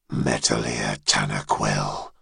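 very low, distant dragon voice; deep dragon voice. Acted. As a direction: speak as an ancient dragon, far below. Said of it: "said the first head".

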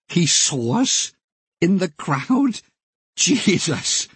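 slow, high disbelief; incredulous. Real, not acted. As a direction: marvel at it, drawn out. Amazed at the racer's remark.

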